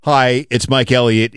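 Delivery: usual speaking voice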